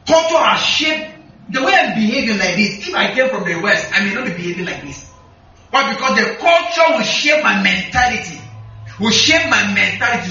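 ordinary talk

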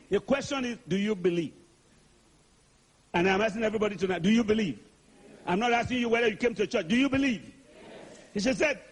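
ordinary talk

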